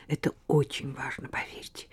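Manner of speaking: urgent pleading whisper; whispered plea. Acted. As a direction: whisper it, begging. Glafira begs in secret.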